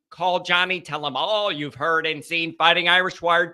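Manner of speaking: usual speaking voice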